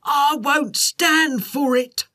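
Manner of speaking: drawn-out indignant protest, hat knocked off; crying out. A narrator's reading. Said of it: "cried Tugbury".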